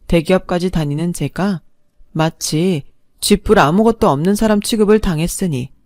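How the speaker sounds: ordinary delivery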